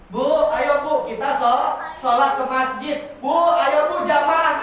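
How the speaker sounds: loud call across the house; loud casual call to the mosque; calling out to wife. Shown as a demonstration. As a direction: call her to prayer, loudly. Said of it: "Kan biasanya kayak gitu ya".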